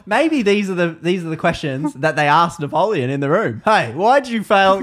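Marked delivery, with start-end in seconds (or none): 3.6-4.8 louder, higher mock heckle; challenging question. Someone in the room questions Napoleon, mock challenging. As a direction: call it out at Napoleon.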